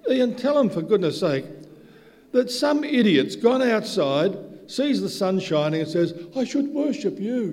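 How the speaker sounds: high, loud, fervent preaching; loud, laughing sermon rant; full-force preaching. Acted. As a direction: drive the point home from the pulpit.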